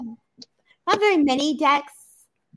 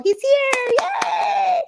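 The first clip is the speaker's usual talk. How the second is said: very high, gleeful squeal; spirit voice; 4 dB louder, much slower than usual. Retold or imitated. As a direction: play the spirit bursting in with joy. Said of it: "comes in so powerful".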